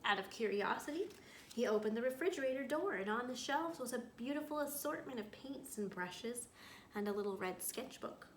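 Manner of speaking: reading a story aloud to a class; storyteller voice for young listeners; picture book read-aloud. A narrator's reading. Teacher reads picture book aloud.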